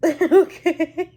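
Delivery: loud, high laughing word. Real, not acted. Telling how the lady ragged her, laughing loudly.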